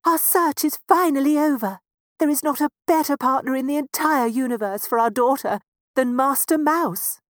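delighted mouse parent voice. Acted. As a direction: celebrate the end of the search. Parents rejoice.

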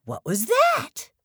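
put-on startled baby bird. A narrator's reading. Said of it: "cried Flap".

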